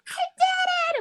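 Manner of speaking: high, joyful squeal imitating her sister; excited scream. Imitating Tabby's reply on the phone, joyful high scream. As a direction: squeal it back like the winner. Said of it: "screaming too".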